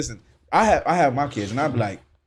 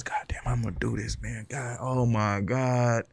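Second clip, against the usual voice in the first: acted stunned whisper, dragging out the end; dismayed whisper; 3 semitones lower, 3 dB quieter, slower than usual. Brother taking in the pregnancy news, acted dismayed whisper. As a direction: whisper it low, as if dread is sinking in.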